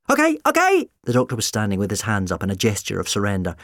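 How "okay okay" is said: high, loud interruption; urgent appeasing shout. A narrator's reading. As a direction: cut in loudly to stop the row. Doctor surrendering amid the shouting.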